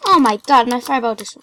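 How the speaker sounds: loud startled outburst; startled exclaim. Real, not acted. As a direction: blurt it in shock. Fireball mishap in game.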